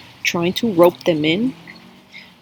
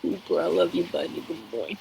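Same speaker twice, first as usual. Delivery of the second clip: tender pet talk; 9 dB quieter than usual. Real, not acted. Speaker turns aside to a good boy, tender and soft. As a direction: drop to a hushed, loving aside.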